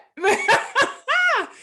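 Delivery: loud, high burst of laughter; incredulous laugh. Real, not acted. Panelist asked to follow a fiery speaker, loud laugh.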